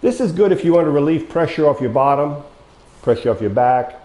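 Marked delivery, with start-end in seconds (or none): none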